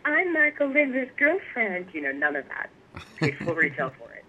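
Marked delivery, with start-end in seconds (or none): 0.1-1.9 high, slow mock voice of a girlfriend cashing in on a name; sing-song show-off pitch, stretched out; mock name-dropper. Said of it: "being like".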